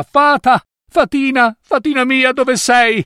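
very high-pitched, searching call. A narrator's reading. Young man searches for his fairy, calling out.

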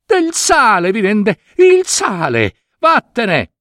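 king banishing his daughter, angry shout. Acted. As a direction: drive her out of your sight. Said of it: "gridò a Maruška il re".

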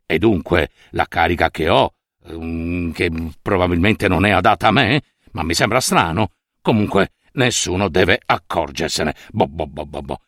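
low, hesitant inner voice with nervous "eh"s; worried official muttering. Acted. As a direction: mumble it as the uneasy official would to himself. Official's continued worried thoughts. (funzionario che borbotta tra sé, preoccupato)

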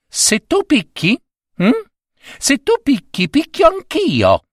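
high piping cricket voice. Acted. Cricket warning the husband in a high voice. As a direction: pipe it up high like a tiny creature. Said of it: "Parlava dunque il grillo".

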